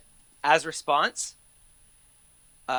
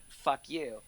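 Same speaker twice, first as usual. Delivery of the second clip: robotic; 9 dB quieter than usual. Retold or imitated. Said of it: "seemed like robots saying".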